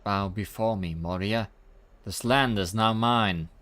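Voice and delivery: loud domineering villain decree; commanding proclamation. Acted. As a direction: command them to kneel. Conqueror claims land.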